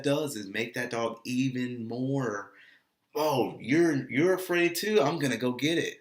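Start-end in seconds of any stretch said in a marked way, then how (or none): 3.1-6.0 higher, louder, excited dog voice; eager aggressive dog. Acted. Dog sensing the owner's fear, excitedly eager. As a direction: pump it up like a dog ready to charge.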